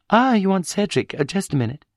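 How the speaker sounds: lightly voiced muddled housewife on the phone; confused wife. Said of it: "sounded a little helpless".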